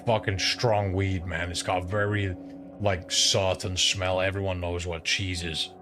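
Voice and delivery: ordinary talk, nothing marked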